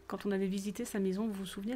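ordinary delivery

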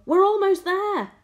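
high, loud, urging call; shout. A narrator's reading. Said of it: "shouted the old green grasshopper".

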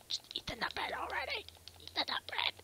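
high whispery squeal; strained urging. Pretend. Player urges Arthur to eat, high strained whisper. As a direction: squeeze it out high and breathy.

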